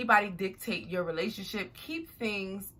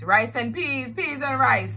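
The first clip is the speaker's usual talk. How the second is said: louder, lightly faked Jamaican lilt; fake Jamaican accent; 5 semitones higher, 6 dB louder than usual. Joking about her ex's mother's cooking, fake Jamaican accent. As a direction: put on a playful, mock Jamaican accent. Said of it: "my fake Jamaican accent".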